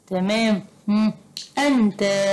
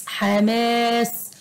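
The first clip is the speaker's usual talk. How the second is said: حماس with long vowel and lingering s; drawn-out word; 2 semitones higher, much slower than usual. Shown as a demonstration. Teacher helping pupils find a letter, slowly stretched حماس.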